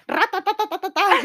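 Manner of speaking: rapid high ra-ta-ta burst, laughing; mock sparking crackle. Friend mimics tinfoil sparking in microwave, quick rattling noise.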